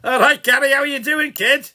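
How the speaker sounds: loud, high, jolly hello with a laugh; excited greeting. Real, not acted. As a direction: greet a mate at full volume, pitched high. Hailing Gary at message's start.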